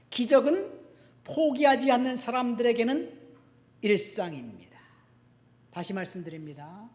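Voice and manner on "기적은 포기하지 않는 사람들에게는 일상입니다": loud, raised preacher's voice; emphatic proclamation. Real, not acted. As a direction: proclaim the key line, dropping at the end. Key line proclaimed, then restated.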